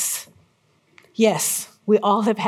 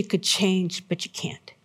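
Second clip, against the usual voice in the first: hushed; 4 semitones lower, 7 dB quieter, slower than usual. Acted. Sermon's hushed turn on shame.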